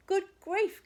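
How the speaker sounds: high gasp of surprise. A narrator's reading. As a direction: gasp it out, shocked. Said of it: "gasped the wolf".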